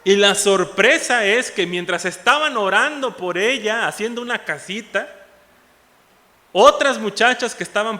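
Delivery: loud fervent sermon delivery; preaching. Acted. Pastor stirring his congregation with the story, loud preaching. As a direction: preach it with rising fervor.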